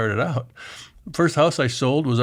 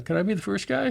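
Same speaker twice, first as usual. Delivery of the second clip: eager high question; 9 semitones higher, 3 dB quieter than usual. Retold or imitated. Eager question in retold advice.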